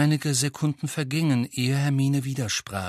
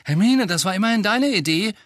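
higher, louder, indignant protest; 9 semitones higher, 6 dB louder than usual. A narrator's reading. Ron protesting to Hermine, indignant and loud. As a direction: throw her own idea back at her. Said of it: "sagte Ron entrüstet".

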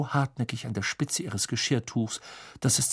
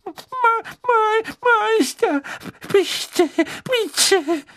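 very high, crying, stammering elf; stammering tearfully; 6 dB louder, much slower than usual. A narrator's reading. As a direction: beg through tears, stuttering. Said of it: "stammelte Winky".

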